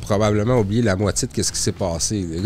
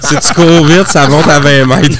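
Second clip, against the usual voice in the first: laughing through the punchline, louder; joking with a laugh; 5 semitones higher, 11 dB louder than usual. Real, not acted. Tech guy jokes to colleagues, laughing through it. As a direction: tease about the GPS error with a laugh.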